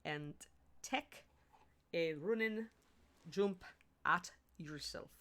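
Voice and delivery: careful word-by-word Scottish accent; halting demo of a Scottish accent. Shown as a demonstration. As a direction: spell out the Scottish accent, a pause after each word. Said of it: "I believe it is".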